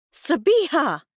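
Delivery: high, loud, scandalised cry; shocked gasp. A narrator's reading. Mum appalled by daughter's rudeness, shocked gasp. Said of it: "Mum gasped".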